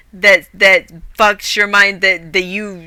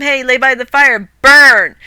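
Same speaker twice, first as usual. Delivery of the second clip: angry mock; 4 semitones higher, 7 dB louder than usual.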